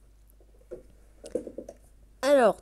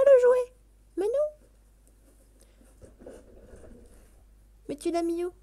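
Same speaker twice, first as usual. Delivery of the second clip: playful cat scolding; 3 semitones higher than usual. Pretend. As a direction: question the cat like a baffled parent. Scolding the cat about toy. (voix de reproche amusé au chat)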